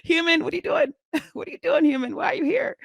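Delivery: high, bemused fish asking why you're here; voicing the fish. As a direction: squeak it out as a puzzled little fish. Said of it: "looking at me like".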